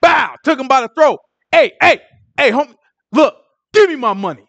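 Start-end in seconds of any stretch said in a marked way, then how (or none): 1.5-4.4 higher, louder, hammed-up shakedown; mock angry demand. Servant grabs fellow by the throat, mock angry demand. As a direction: bark it like a street collector, half-acted.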